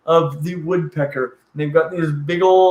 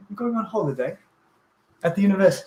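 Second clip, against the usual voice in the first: put-on British accent, softer; 4 dB quieter, faster than usual. Shown as a demonstration. Host copying co-host's British phrases, soft put-on British accent. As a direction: play a Brit with a light touch. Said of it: "Oh, British".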